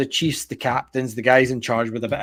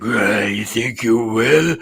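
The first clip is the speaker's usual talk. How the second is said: slow, low mocking orc; mock orc, drawn out; sceptical orc; 4 semitones lower, slower than usual. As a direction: scoff it like a guard. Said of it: "the orc's like".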